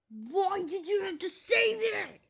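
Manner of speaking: high, strained mock outcry; outraged cry. Vlogger answering a hater's comment, high outraged whine.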